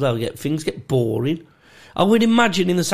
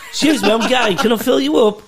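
mock camp request; 8 semitones higher, 5 dB louder than usual. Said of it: "as if the bloke had gone".

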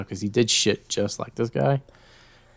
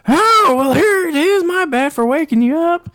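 much higher, louder scratchy voice; Richard caught out; 7 dB louder than usual. Retold or imitated. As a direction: brush off the mistake smugly.

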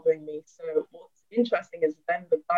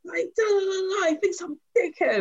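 very high-pitched mock whining; hammed-up wounded complaint, higher-pitched; aggrieved grumbling; 12 semitones higher than usual. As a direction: imitate a man still ranting to himself. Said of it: "still hear him downstairs going".

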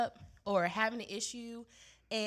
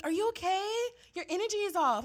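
higher, concerned-sounding colleague; prying coworker; 9 semitones higher, 5 dB louder than usual. Retold or imitated. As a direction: play the nosy coworker asking. Coworker questions her mood.

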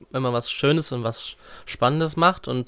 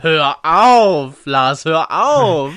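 loud protest; 2 semitones higher, 10 dB louder, much slower than usual. Acted. Mock protest, laughing.

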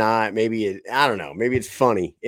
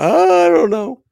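put-on much higher, thin, quavering old-man voice; imitating old grandpa; 6 dB louder, slower than usual. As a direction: squeak it out like a frail ninety-seven-year-old. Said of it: "this is how he talks".

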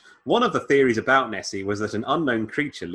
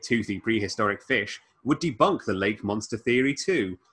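ordinary talk

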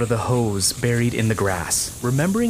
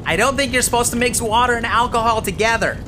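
high frantic yell; frantic shout; 11 semitones higher, 4 dB louder than usual. Acted. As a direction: shout it back in a panic. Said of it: "Tony frantically shouted back".

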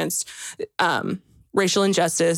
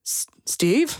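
hesitant whisper; 5 semitones higher than usual. Retold or imitated. Acting out checking an Uber.

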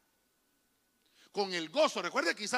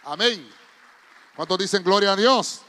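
loud, driving call to clap; rousing shout; 15 dB louder than usual. Real, not acted. Preacher urges the church to clap for the King, shouting. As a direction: whip up the congregation.

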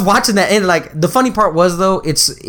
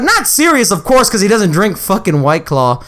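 ordinary talk, nothing marked

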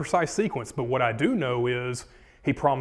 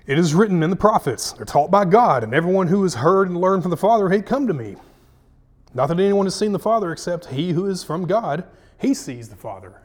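raised, loud, emphatic verse reading; scripture reading; 5 semitones higher, 6 dB louder than usual. Acted. Preacher reading Jesus' answer to the grumblers, forceful proclamation.

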